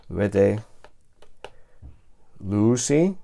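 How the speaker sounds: each Greek form read out slowly and carefully, with pauses between; sounding out ancient Greek forms; reading Greek verb forms aloud. Shown as a demonstration. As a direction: spell out each ending clearly for the students. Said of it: "igual que en el presente".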